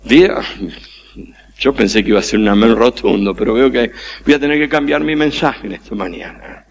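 ordinary talk

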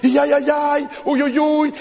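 hammed-up lament sounds; mock wailing. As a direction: caricature the mourners' moaning. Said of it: "cosas que decimos cuando alguien muere".

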